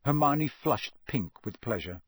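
plain reading voice

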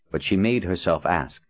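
usual speaking voice

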